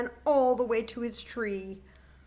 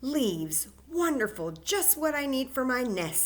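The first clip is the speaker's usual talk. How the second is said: delighted squirrel; 5 semitones higher, 5 dB louder than usual. Acted.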